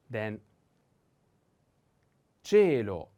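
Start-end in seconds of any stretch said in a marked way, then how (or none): 2.4-3.1 cielo with a loud, long E; stress on E. Shown as a demonstration. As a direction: lean hard on the E in "cielo", then fall. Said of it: "the accent is on the E".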